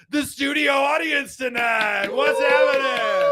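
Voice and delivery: loud hype-man greeting; hype shout. Acted. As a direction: call out to the crowd. Hyping the studio audience.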